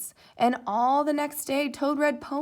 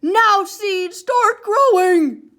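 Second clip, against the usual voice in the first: very loud, slow, high shout; 9 semitones higher, 16 dB louder, much slower than usual. A narrator's reading. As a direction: yell at the ground with full force.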